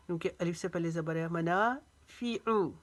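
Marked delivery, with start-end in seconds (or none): 1.3-2.7 मनाफ़िउ with ना stretched, फ़ि short; long ना only. Shown as a demonstration. Teacher demonstrating madd, मनाफ़िउ with long ना. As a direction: draw out ना in मनाफ़िउ, clip फ़ि. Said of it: "फ़ी को लंबा नहीं करेंगे".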